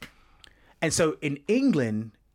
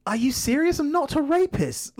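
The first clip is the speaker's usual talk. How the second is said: indignant retort; 12 semitones higher than usual. Retold or imitated. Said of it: "And I'm like".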